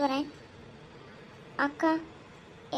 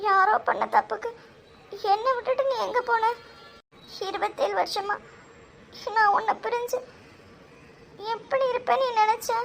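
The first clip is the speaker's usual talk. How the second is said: high, emotional outpouring; hurt plea rising in pitch; anguished reproach; 5 semitones higher than usual. Acted. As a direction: pour out years of hurt at someone dear.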